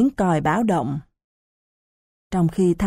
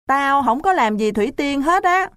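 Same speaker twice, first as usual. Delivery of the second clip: grumpy protest; 7 semitones higher than usual. Acted. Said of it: "Lâm quạo quọ".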